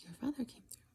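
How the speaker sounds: ordinary talk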